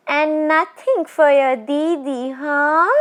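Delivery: high, eerie teasing spirit voice; a tall witch toying with her prey; ghost woman. Acted. Ghost woman teasing the frightened Bishan Singh, eerie and high.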